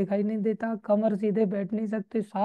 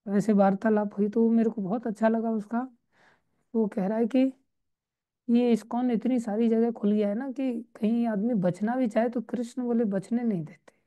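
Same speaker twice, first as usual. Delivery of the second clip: ordinary talk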